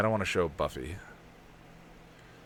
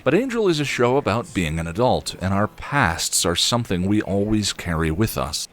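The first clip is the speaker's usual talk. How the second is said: sultry narrator; 8 dB louder than usual. Shown as a demonstration. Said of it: "I got all sultry voice there".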